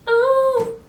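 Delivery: surprised gasp. Real, not acted. Sudden exclamation.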